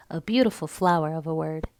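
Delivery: plain reading voice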